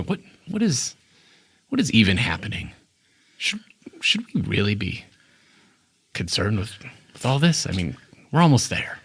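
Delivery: soft, uneasy muttering from a chair; nervous passenger half to himself; worried voice. Acted. Hidden passenger fretting to others, soft and uneasy. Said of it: "you hear a voice go".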